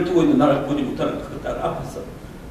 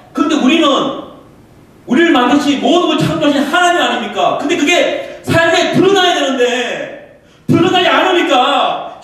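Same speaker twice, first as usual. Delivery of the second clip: raised, urgent sermon voice; fervent preaching; 9 semitones higher, 6 dB louder than usual. Acted. Pastor exhorting the congregation about rote faith, fervent preaching. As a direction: drive the point home to the congregation.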